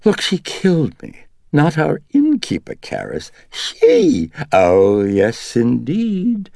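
high, loud wheedling dandy. A narrator's reading. The Dennings personality wheedling at Karras to let it stay, high and loud. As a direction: coax him, all charm and grievance.

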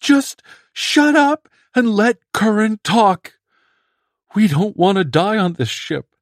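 high, loud screaming; screamed. Acted. Swope screams at Bedzik to let Curran talk, high loud scream. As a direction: scream him into silence. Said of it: "and he screamed".

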